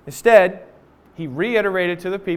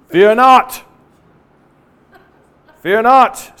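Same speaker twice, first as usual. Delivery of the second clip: loud repeated call to calm the crowd; commanding shout; 2 semitones higher, 9 dB louder, much slower than usual. Retold or imitated. Moses calling out to frightened people, loud firm command. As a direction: ring it out firmly, twice.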